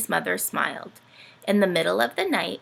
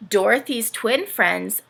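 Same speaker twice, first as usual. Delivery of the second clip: plain reading voice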